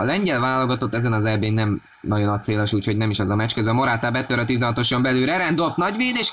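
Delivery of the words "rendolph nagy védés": excited. Real, not acted. Big save called.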